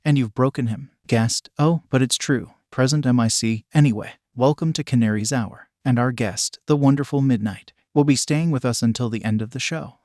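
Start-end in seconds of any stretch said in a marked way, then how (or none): none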